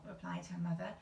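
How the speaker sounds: ordinary delivery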